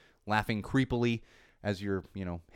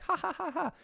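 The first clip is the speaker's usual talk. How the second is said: high ha ha ha, light and silly; a goofy high laugh; plain mock laugh. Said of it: "And not just laugh like".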